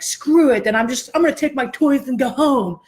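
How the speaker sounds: high, huffy mock quitting; passive aggressive. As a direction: flounce off in a pretend sulk. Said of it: "then we get passive aggressive".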